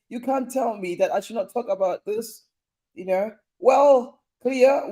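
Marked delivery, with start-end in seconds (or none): 3.6-4.9 mock big announcement. Jokey appeal opening.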